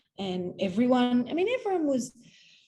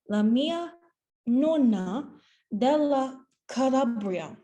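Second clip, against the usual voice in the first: halting Italian; 3 semitones higher, 3 dB louder, slower than usual. Retold or imitated. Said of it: "the only Italian sentence I knew".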